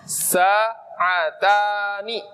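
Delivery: Sa'atani stretched out very slowly, syllable by syllable; teacher's drawn-out dual ending; slow model reading. Shown as a demonstration. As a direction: draw out Sa'atani slowly so students copy it. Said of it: "Dikasih nun yang kasroh".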